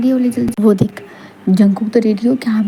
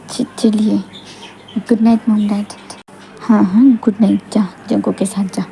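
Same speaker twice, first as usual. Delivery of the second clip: usual speaking voice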